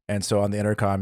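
ordinary talk

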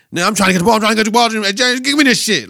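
mock Anthony Edwards. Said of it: "and he was like".

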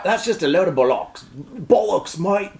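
hammed-up English "mate" accent. Co-host trying bollocks for the others, English accent. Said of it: "Can you do an English accent?".

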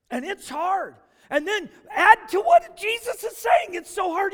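high, loud preacher's cry; fervent preaching. Acted. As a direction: preach it out to the whole church. Preaching at full force.

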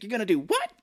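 high, incredulous movie quote; mock disbelief. Merlin questioning Maverick's plan, mock incredulous. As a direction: shoot up in comic alarm.

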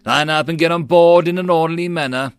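commanding, projected shout; shouted order. A narrator's reading. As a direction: call orders across the ranks. Officer orders men onto barges.

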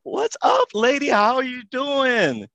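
high, slow, gushing hello; excited greeting. Real, not acted. Opening greeting to the guest.

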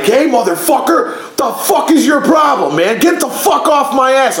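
high-pitched angry yell, retold; worked-up road-rage holler, not full volume; retold screaming. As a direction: re-enact yelling out a car window. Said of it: "I start screaming".

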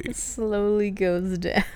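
words sinking gradually, like a soft-close lid; slow motion. Pretend. Host narrating the cat's leg lowering, in slow motion. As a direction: draw each word out slowly.

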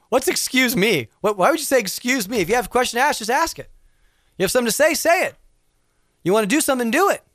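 fired-up blunt retort; blunt Israeli attitude. Acted. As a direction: hammer the point home with mock bluntness.

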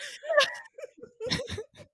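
high, squealing laugh; helpless laughter. Real, not acted. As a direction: crack up completely at your own story. Cracking up at own story.

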